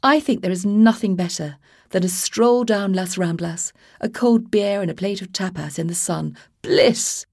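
posh prattler chattering to the skinhead; posh English accent, oblivious and gushing. Acted. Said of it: "sounded like he'd swallowed a silver spoon".

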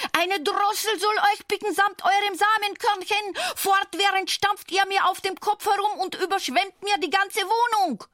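hoch und laut keifend; wütender Regenwurm. Acted. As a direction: schimpft wie ein wütender kleiner Wurm. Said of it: "schrie wütend". (wütend keifender Regenwurm)

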